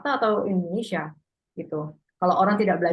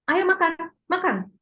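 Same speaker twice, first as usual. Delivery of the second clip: "Ayo makan, makan" pitched much higher, urging; parent ordering; 10 semitones higher than usual. Shown as a demonstration. Parent telling child to eat, urging high voice. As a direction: call the child to eat, insistent. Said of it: "jadi menyuruh".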